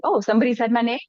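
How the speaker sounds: high, playful perk-up at hearing one's name; playful voice of an attentive brain; eager listening brain. Pretend. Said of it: "It's like the brain is listening".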